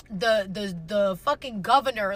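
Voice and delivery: ordinary talk, nothing marked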